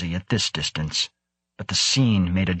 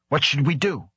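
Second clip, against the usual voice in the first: higher, mildly angry demand; snarling demand; 6 semitones higher than usual. A narrator's reading. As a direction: demand an answer from the specialist. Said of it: "Krebs was snarling".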